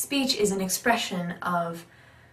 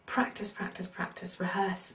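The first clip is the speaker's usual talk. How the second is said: whispered; 8 dB quieter than usual. Shown as a demonstration. Urging repetition, whispered.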